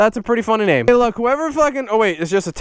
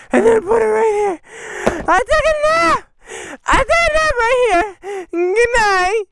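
high, slow put-on voice; hammed-up high whimper; mock sleepy whine; 11 semitones higher than usual. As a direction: play a silly overtired character.

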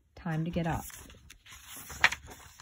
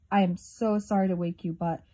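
ordinary delivery, nothing marked

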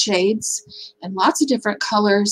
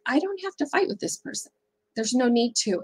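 much softer, settled and peaceful; calm inner resolve; 7 dB quieter than usual. Retold or imitated. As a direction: say it to yourself, letting the fight go.

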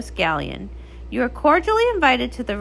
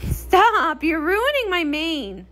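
laughing protest; 4 semitones higher, slower than usual. Acted. Laughing at sister's teasing.

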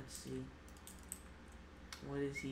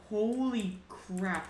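amazed; 6 semitones higher, 8 dB louder, much slower than usual. Real, not acted. After insane play.

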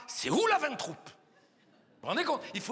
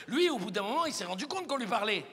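usual speaking voice

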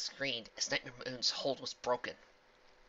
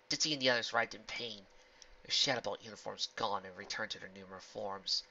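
ordinary delivery

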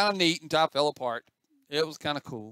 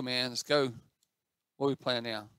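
ordinary delivery

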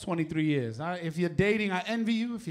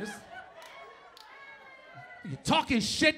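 ordinary talk

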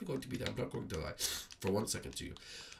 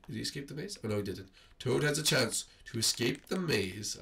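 ordinary talk, nothing marked

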